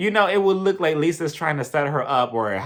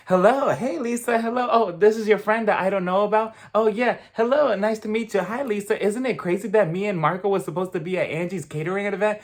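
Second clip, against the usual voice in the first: high, eager, pushy gushing voice; mock Aubrey; 5 semitones higher than usual. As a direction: play the overeager castmate fishing for a fight. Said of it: "she came up to the table and she was like".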